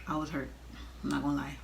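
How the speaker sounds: normal talking voice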